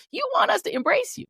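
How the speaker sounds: shouted high, the word want rising sharply; incredulous shout. Real, not acted. Repeating the accusation to the right as an incredulous shout. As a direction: yell the accusation back at them, pitch climbing.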